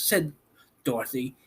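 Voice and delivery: usual speaking voice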